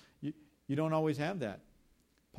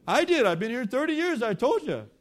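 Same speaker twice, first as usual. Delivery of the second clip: loud, high acted insistence; emphatic shouting; 10 semitones higher, 11 dB louder than usual. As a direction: shout it back as if it's obvious. Castaway insists to doubting newcomer.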